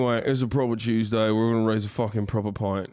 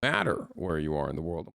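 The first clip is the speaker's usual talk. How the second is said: ordinary delivery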